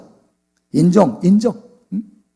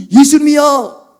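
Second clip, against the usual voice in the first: high, drawn-out excited cry; acted-out thrilled shout; excited recognition; 7 semitones higher, 3 dB louder, slower than usual. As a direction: call it out on suddenly recognising someone beloved. Disciple recognises Jesus from the boat.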